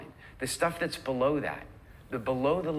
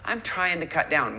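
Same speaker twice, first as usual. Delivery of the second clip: hesitant excuse; 5 semitones higher than usual. Acted. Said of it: "if you say to your friends".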